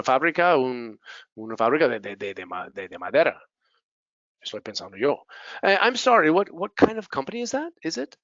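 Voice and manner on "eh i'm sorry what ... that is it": higher-pitched puzzled query; acted confused politeness; polite puzzlement. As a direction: ask again apologetically, pitched up. Acts out own puzzled question.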